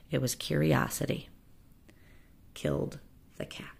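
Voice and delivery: soft sad closing hush; sad whisper. Acted. Doctor Varmint looks sadly at the horizon, whispering. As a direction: let it fade to a whisper. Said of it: "looked sadly".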